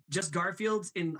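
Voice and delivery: usual speaking voice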